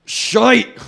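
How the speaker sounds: shouted high Scottish "shite"; very loud put-on Scottish burr; mock Scottish accent. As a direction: bellow it like a hammed-up Scot.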